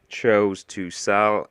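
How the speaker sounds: ordinary delivery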